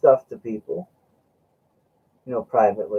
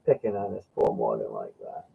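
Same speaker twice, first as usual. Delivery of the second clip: lower mock reproach; 13 semitones lower, 7 dB quieter than usual.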